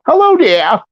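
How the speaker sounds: very high, light greeting; high squeaky voice. Retold or imitated. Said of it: "What's wrong with your voice?".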